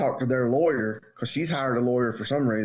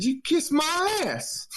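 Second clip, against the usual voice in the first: high, slow mock old-woman voice; imitating the cranky old neighbor, high and slow; 13 semitones higher, much slower than usual. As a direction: drag it out like the cranky neighbor lady. Neighbor's retort retold.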